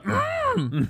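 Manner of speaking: high, thin hum like the little Jedi; Yoda grunt. Pretend. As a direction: hum before speaking. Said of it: "You also have that".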